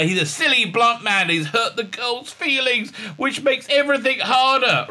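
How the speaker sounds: high-pitched mocking talking-down; mock condescension. Woman talking down to a blunt man, mock condescending. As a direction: scold like you're talking down to a silly man. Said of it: "she just talks down to him".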